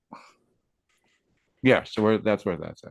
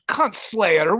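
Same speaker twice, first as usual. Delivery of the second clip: Javier Milei impression; 10 semitones higher, 4 dB louder than usual. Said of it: "he says".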